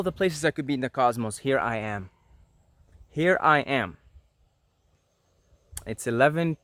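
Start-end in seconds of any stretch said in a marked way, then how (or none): none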